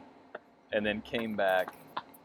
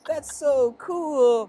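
high, slow, louder gush; awed locals; 14 semitones higher, 7 dB louder, much slower than usual. Pretend. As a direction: stretch it out in amazement. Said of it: "And they're like".

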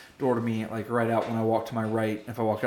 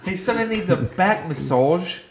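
fake European accent; 6 semitones higher than usual. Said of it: "terrible, like, South African accent".

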